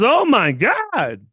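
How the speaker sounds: high, loud, slow mock gasp of disgust; shocked nurse. Stretched-out horrified oh my God. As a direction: nurse reacting to the smell, high and drawn-out in shock. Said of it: "and she goes".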